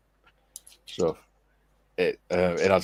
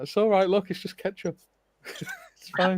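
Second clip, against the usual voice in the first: laughing reassurance; 12 semitones higher than usual. Retold or imitated. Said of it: "I took the glove and I went".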